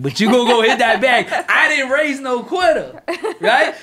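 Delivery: loud, high, insistent mama voice; mother's scolding. Retold or imitated. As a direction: lay down the law like a fired-up mother. Imitating mama scolding her son.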